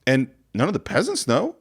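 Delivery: ordinary talk, nothing marked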